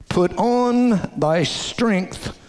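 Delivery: drawn-out, high, every word weighed; slow emphasis. Shown as a demonstration. Preacher reading scripture to congregation, slow and lifted. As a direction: stretch it slowly like a proclamation. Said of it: "said with emphasis".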